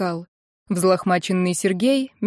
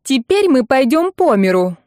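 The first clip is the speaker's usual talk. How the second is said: high, louder lament; wailing on one note; 5 semitones higher, 5 dB louder than usual. A narrator's reading. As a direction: keep up a hysterical whine of ruin. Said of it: "продолжала Ирка на одной ноте". (причитает на одной ноте)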